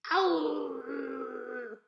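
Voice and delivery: loud howling ow; dog howl. A narrator's reading. Dog interrupting the sermon, loud howl. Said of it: "said Winn-Dixie".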